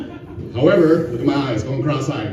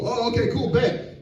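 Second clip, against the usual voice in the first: ordinary talk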